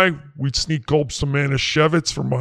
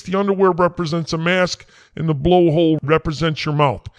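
usual speaking voice

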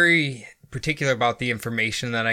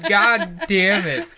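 mock despair; 8 semitones higher, 5 dB louder, slower than usual. Real, not acted. Mock despair over ice dams.

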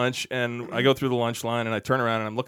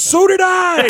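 mock chiming in; 13 dB louder, slower than usual. [name]'s deadpan punchline.

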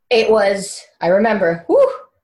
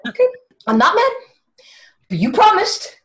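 high, tight mock composure; wronged wife holding it in; swallowed anger; 11 semitones higher than usual. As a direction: pretend you're not mad, pitch climbing. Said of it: "I swallowed my anger".

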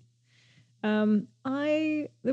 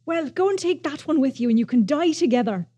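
put-on a light Irish accent, a bit louder; 2 semitones higher, 6 dB louder than usual. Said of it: "that was my Irish".

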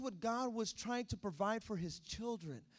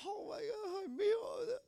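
tearful mom; 11 semitones higher than usual. Retold or imitated. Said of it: "I remember my mom".

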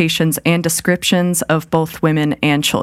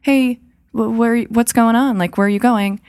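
higher, rising panic in the questions; mock alarmed; 6 semitones higher than usual. Host voicing the kidnapped woman noticing missed exits, alarmed. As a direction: sound suddenly alarmed and confused. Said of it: "she was like".

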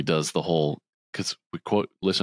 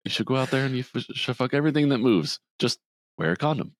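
fast mock doctor advice; 8 semitones higher, faster than usual.

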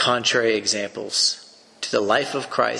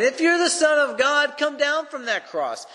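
much higher, jeering challenge; mocking taunt. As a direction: sneer up at the man on the cross. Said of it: "they were mocking him".

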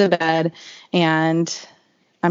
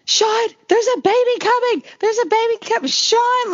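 much higher pitch, imitating a panicked call without real volume; mock yell for help. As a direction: call out like mom summoning help, held back. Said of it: "yelling for my husband".

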